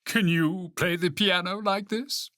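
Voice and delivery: high, boastful question; high-pitched proud challenge; crying out. A narrator's reading. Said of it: "cried Toad".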